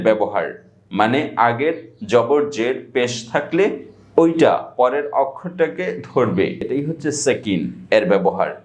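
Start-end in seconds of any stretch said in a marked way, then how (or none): none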